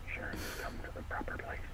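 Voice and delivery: put-on whispered old sea dog; whispered pirate voice. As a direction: breathe it like a secretive pirate. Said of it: "I love your pirate impression".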